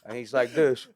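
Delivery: loud, high, laughing through the words; real laughter breaking through the story; laughing while telling. Real, not acted. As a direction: crack up as you describe it.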